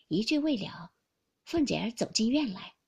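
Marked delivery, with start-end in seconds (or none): none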